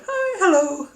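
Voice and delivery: high, loud, bubbly hello with a laugh in it; chirpy greeting. Retold or imitated. To the lady on the phone, mimicking her own cheerful hello. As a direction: squeal a cheery hi like a ray of sunshine. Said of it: "you come on going".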